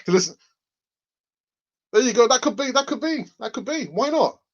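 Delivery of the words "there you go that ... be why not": high-pitched chipmunk. Acted. Host demos a members' chipmunk perk, high chipmunk voice. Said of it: "chipmunk version".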